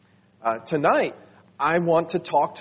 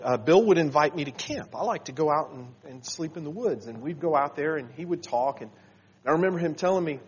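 normal talking voice

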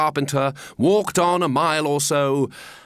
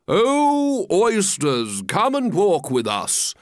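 grand coaxing call, starting high; beseeching; 5 semitones higher, slower than usual. Acted. Walrus invites the oysters to walk, beseeching grandly. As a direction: beseech them with a long, swooping opening. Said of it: "the walrus did beseech".

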